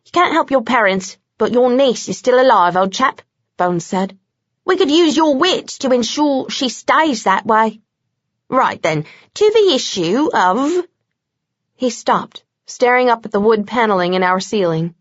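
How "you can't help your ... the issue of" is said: lightly voiced British vampire, coaxing then brisk; a hint of a cocky British vampire; Bones' voice. A narrator's reading. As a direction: steer the old chap along, lightly British.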